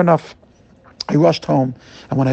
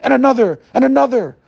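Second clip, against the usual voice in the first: rising, louder each and another; building and another; 8 semitones higher, 3 dB louder than usual. Retold or imitated. Telling the rabbi of each cup, voice climbing. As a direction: climb higher with each repeat.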